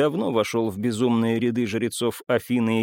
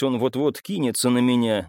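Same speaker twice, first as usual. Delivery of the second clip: ordinary delivery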